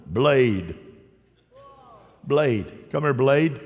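loud mock call to a child. Pretend parent calling Blade inside, loud. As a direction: holler Blade across the yard.

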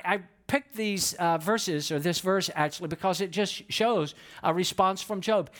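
ordinary talk

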